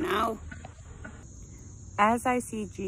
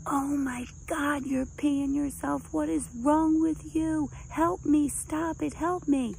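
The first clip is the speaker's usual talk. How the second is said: put-on panicked boyfriend; 9 semitones higher, 3 dB louder than usual. Boyfriend in pain pleading with the girlfriend for help, high hammed-up panic. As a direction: mimic his frantic pleading, pitched way up. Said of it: "And he goes".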